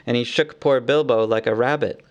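usual speaking voice

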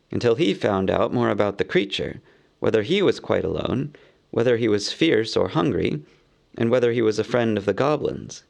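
ordinary delivery, nothing marked

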